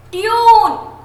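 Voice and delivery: ट्यून high and loud with long "yoo"; ट्यून held long, falling. Shown as a demonstration. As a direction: stretch out ट्यून. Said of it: "लंबा जा रहा है".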